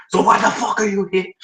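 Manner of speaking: high-pitched acted outburst; exasperated. Manager berating the talentless applicant, exasperated.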